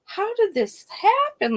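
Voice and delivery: high, slow bewilderment; stunned disbelief. Retold or imitated. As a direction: ask it in shock. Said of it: "And I'm like".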